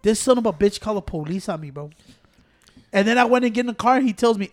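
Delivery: normal talking voice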